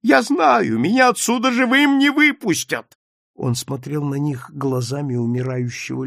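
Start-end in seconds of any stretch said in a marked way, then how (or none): 0.0-3.0 high, loud desperate cry; breaking voice. A narrator's reading. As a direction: cry out in fear for your life. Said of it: "послышался его срывающийся голос". (отчаянно, срывающимся голосом)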